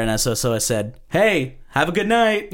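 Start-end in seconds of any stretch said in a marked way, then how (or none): 1.1-2.5 high, pointed mock-friendly farewell; pointed call-out. Unseen comic to the group leaving the bar, pointedly calling out. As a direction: call out so they notice you. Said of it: "trying to, like, let them know".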